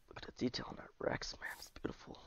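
hushed whisper of admiration; awed whisper. Real, not acted. Gamer marvels at a tiny Rex model, whispering in awe. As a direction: whisper it like you're afraid to break the spell.

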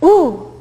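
high, loud alarmed ooh; worried crowd ooh; audience ooh. Shown as a demonstration. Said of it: "the other sound is".

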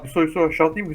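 loud, calling to a friend. Real, not acted. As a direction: shout across to a friend.

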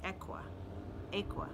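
Ekua said as EK-wah, tried twice; practicing a name. Shown as a demonstration. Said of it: "I practiced her name".

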